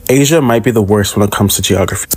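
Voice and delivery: ordinary talk, nothing marked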